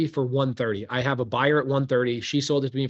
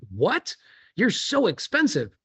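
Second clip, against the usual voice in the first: mock disbelief, pitched up and drawn out; incredulous borrower; 7 semitones higher, slower than usual. Borrower scoffing at the lender's quote, mock incredulous. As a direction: play the shocked borrower balking at the price.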